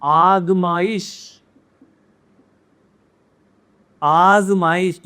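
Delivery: slow आजमाइश with a buzzing ज़; Persian ज़ in आजमाइश. Shown as a demonstration. Teacher slowly modeling the Persian ज़ in आज़माइश. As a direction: stretch आजमाइश, buzz the ज़. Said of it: "ये ज पे है".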